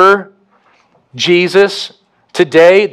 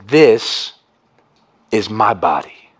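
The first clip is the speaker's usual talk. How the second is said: low, slow and grave, each word weighed; solemn hushed words; 8 semitones lower, slower than usual. Acted. Preacher voicing Jesus at the table, low and grave. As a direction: lay the bread words down slowly.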